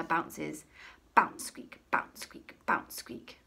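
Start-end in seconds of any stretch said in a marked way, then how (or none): none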